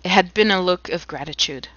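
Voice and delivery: ordinary delivery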